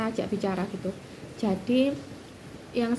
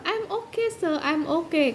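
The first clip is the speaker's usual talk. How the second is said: higher, slow, mock-polite I'm okay; polite English reply; 6 semitones higher, slower than usual. Telling promotor two she is fine, lightly voiced polite English. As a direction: play it small and polite to the professor. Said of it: "sukanya ngomong pakai bahasa Inggris".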